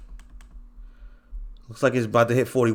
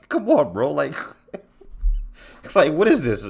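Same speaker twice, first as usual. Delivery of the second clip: laughing disbelief; 4 semitones higher than usual. Real, not acted. Laughing at viewers' predictions.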